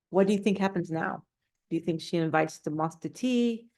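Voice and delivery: usual speaking voice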